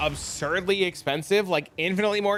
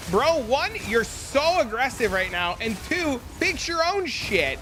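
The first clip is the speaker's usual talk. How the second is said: high, slow incredulous bro; mock exasperated retort; 7 semitones higher, slower than usual. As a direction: lecture him in disbelief. Retelling argument with viewer.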